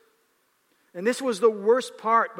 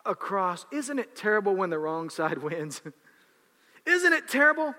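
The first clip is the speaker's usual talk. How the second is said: ordinary talk, nothing marked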